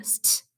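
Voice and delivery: "St" breathed out, unvoiced; whispered blend. Shown as a demonstration. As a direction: hiss the "St" softly. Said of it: "Stop begins with the blend".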